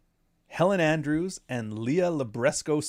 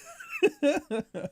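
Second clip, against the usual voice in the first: real laugh breaking out high; cracking up at the absurd news; high burst of laughter. Real, not acted. Laughing at Dinesh D'Souza's post, a real high laugh.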